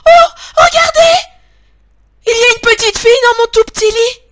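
very high, loud little squeal; piercing little bear cry. A narrator's reading. Tiny bear finds a girl in his bed, piercing high cry. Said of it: "crie de sa petite voix perçante".

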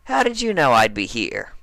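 suspicious question as Dash; a hint of suspicious Dash; Rainbow Dash, suspicious.

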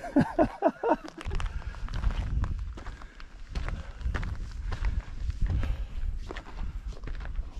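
long high breathless laugh; long breathy giggle. Real, not acted. Laughs at clothes rack.

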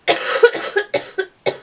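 hoarse sick cough. Real, not acted. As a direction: cough from a cold.

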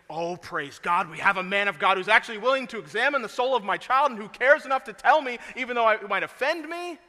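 loud, rising, grateful outburst of praise; grateful parent. Retold or imitated. A parent thanking the pastor, loud and overjoyed. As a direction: burst out thankful and high-pitched.

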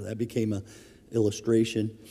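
ordinary talk, nothing marked

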